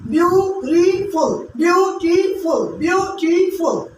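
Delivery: beautiful drawn out slowly, each syllable clear. Shown as a demonstration. Teacher spelling out syllables for students, slow and clear. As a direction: say beautiful slowly: beau-ti-ful. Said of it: "Three sounds".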